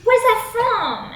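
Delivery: high, slow drawled question; slow, lifted creep voice; swaggering guy. Acted. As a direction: stretch it out like a creepy flirt. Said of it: "And he goes".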